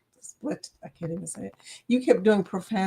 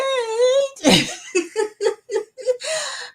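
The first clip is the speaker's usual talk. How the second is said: high, helpless laugh; 14 semitones higher than usual. Real, not acted. Laughing at chat joke.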